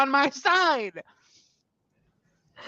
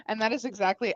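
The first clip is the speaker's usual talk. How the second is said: usual speaking voice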